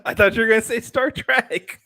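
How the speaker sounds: high, laughing through the line. Real, not acted. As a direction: still giggling while answering. Still laughing after joke.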